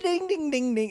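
high sung guitar-riff dings; imitated soundtrack riff. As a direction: mimic an upbeat rock-and-roll intro with dings.

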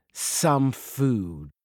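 plain reading voice

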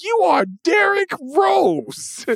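high, slow, stretched-out disbelief; incredulous outburst. Retold or imitated. Telling the young star who he is, high and slow. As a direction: spell it out like he can't believe it. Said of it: "I'm like".

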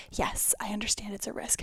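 breathy whisper of resignation; whispering it to herself; whispered aside. Real, not acted. Whispered aside about the risk.

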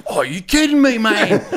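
high, loud Aussie disbelief; terrible Australian accent. Retold or imitated. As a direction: exclaim as an astonished Australian fan, hammed up. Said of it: "I'm gonna do a terrible Australian accent".

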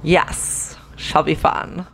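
ordinary delivery

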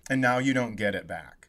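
usual speaking voice

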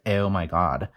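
put-on Valley Girl accent with a fronted O; hammed-up Valley Girl vowels; exaggerated Valley Girl. Shown as a demonstration. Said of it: "I'm gonna exaggerate it, like a Valley Girl sound".

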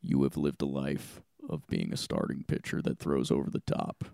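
very low, eerie Saw puppet voice; too calm creepy doll. Retold or imitated. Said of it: "you sound like the Saw clown".

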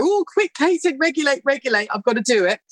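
high, hurried panic, reminding herself; flustered self-talk. Retold or imitated. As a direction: jump up in pitch like you've just caught yourself, then rush it.

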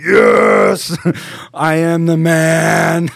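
loud, slow triumphant yell; pumped victory shout. Retold or imitated. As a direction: roar it like a champion. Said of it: "Like, so pumped".

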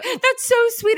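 put-on very high drunken cooing; drunk friend. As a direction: coo it like a sloshed friend. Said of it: "Yeah, she's drunk".

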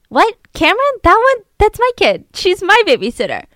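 high, loud disbelief; stunned mother. Retold or imitated. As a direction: react like the floor dropped out.

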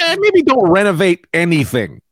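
cop impression. Cop advises against renovating, mock warning.

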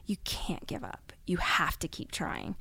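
a stranger leaning in, whispering close; urgent whisper. Retold or imitated. Stranger at next table urging the couple, in a whisper. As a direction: hush it, pressing and intimate. Stranger's plea, retold hushed.

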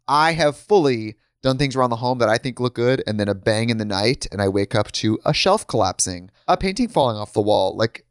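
upbeat sponsor read; bright host-read sponsor spot. Acted. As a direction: sell it like a podcast host ad.

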